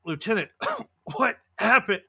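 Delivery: words broken by a cough; coughing, weakened patient. Acted. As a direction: voice an injured officer coughing as she speaks. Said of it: "coughs a little bit".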